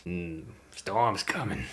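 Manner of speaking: ominous fisherman. Acted. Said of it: "He says".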